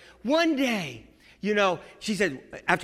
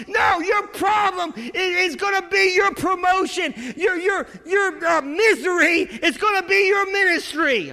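full-force preaching; 9 semitones higher, 6 dB louder than usual. Real, not acted. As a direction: hammer each line at the congregation.

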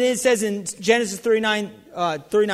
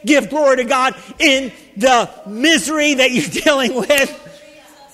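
normal talking voice

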